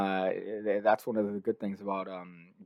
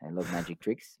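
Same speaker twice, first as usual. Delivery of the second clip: put-on shy child learner; 3 semitones lower, 6 dB quieter than usual. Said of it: "I was".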